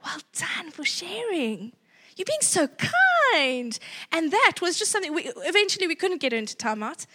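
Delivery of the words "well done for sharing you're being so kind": praising. Retold or imitated. Staff praising a girl for sharing, slow and sweet. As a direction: coo like praising a child. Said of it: "she was hearing".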